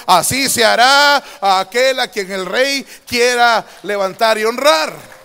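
slow, very loud herald's cry; booming slow proclamation; royal proclamation. Acted. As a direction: announce it to the whole city like the king's herald. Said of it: "empiezan a anunciar".

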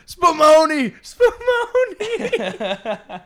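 very high, loud yelling; comic shout. Acted. Bear dominating people in prison shower, high drawn-out yell. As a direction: holler it, stretching the second one out slowly.

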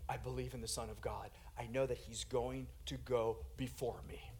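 near-whispered, low affirmation; very quiet, low voice of trust; hushed faith. Acted. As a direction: say it softly, as a private confession. Paul's quiet faith at open doors.

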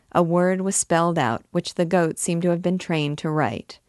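ordinary delivery, nothing marked

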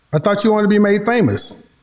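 ordinary delivery

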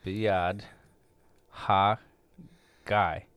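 Biyad, Ha, Guy said slowly with a pause after each part; sounding out the Hebrew. Shown as a demonstration. Sounding out the Hebrew slowly for his co-host. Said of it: "By the hand".

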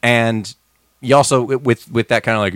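ordinary talk, nothing marked

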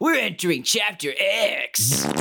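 slow, high announcer boom with echo; radio announcer. Pretend. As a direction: announce it like a cheesy radio host. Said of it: "shitty radio thing to say".

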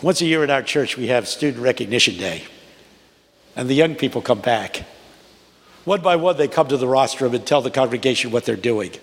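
ordinary talk, nothing marked

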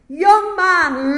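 high, very loud pulpit shout; preacher's shout. Acted. Primitive Methodist preacher shouting at a young stranger, full shout. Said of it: "Then he shouted, as only a primitive Methodist can".

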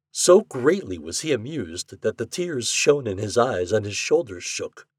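plain reading voice

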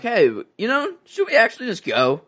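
put-on tentative girlish suggestion; lightly voiced teen girls; high mock girl voice. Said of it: "they're like".

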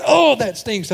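put-on high, loud little boy's yelp of disgust; kid voice. Six-year-old son grossed out by the smell, high loud yelp.

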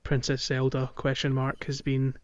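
normal talking voice

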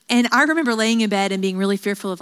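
ordinary talk

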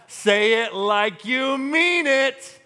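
mock-stern classic parent line; scolding parent. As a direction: stretch each word like a fed-up parent. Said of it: "follow that up with this classic line".

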